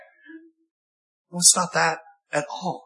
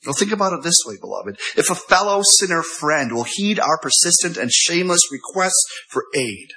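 ordinary talk, nothing marked

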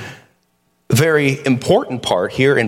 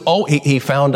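ordinary delivery

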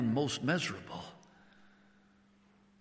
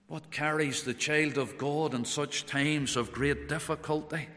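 normal talking voice

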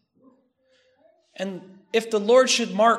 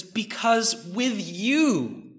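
slow, reverent reading of the verse; tender psalm quote; 3 semitones higher, slower than usual. Acted. Preacher quoting the psalmist, in slow reverent awe. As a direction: let it land slowly with awe. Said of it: "He says".

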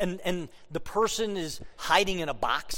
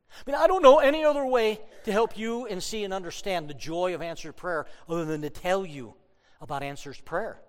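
normal talking voice

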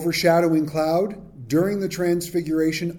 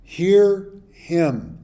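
slow, loud, lightly voiced divine pronouncement; voice of God; 4 semitones higher, 5 dB louder, much slower than usual. The voice from the cloud to the disciples, slow and loud. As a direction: read it slowly like a voice from the cloud. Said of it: "a voice came out of the cloud saying".

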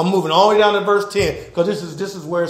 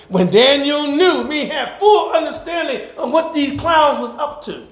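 preaching; 7 semitones higher than usual. Acted. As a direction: proclaim it to the congregation. Preaches the verse.

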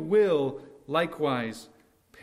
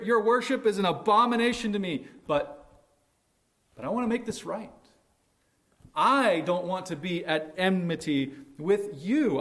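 exasperated God; 6 semitones higher, 3 dB louder than usual. Retold or imitated. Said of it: "and he's saying".

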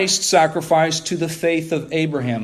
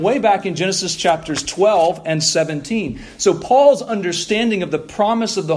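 raised, impassioned preaching voice; preaching at full force; 4 semitones higher than usual. Real, not acted.

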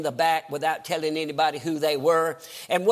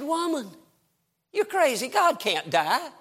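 high-pitched disbelief, dragging out the last words; incredulous husband; 8 semitones higher than usual. Retold or imitated. Martin Luther answers his wife in mourning, incredulous. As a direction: scoff at her in a high, incredulous voice.

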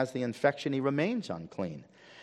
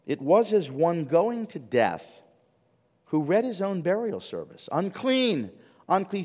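raised voice, solemn recital; dramatic reading; 7 semitones higher, 3 dB louder, slower than usual. Acted. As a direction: read it out high, as a proclamation.

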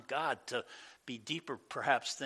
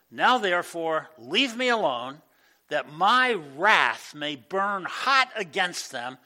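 wrathful God; 2 semitones higher, 8 dB louder, slower than usual. Acted. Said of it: "God's ticked".

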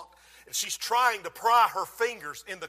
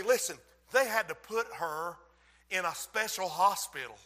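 normal talking voice

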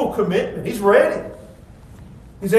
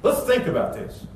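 lower, soft and measured; calm Jesus; 9 semitones lower, 7 dB quieter than usual. Retold or imitated. Jesus asking the scribe to count the cost, calm and soft. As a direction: reason with him quietly. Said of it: "So Jesus says".

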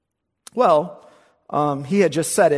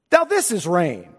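East Coast local. Retold or imitated. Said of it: "let me show you rain now".

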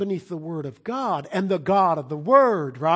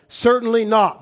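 much higher and louder, hammered down; emphatic shout; 6 semitones higher, 8 dB louder than usual. Shown as a demonstration. Preacher answering his own question to the congregation, emphatic shout. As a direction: bark the answer out with finality.

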